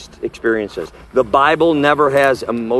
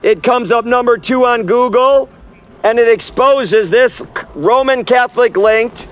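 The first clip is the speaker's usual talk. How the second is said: raised, higher preaching voice calling the crowd to repent; street preaching; 7 semitones higher, 3 dB louder, slower than usual. Acted. Street preacher warning the crowd away from the festival, preaching at full force. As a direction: preach it out to the passers-by, slowed on the key lines. Closing exhortation to the audience.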